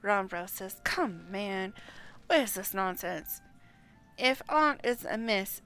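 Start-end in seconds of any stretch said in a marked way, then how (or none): none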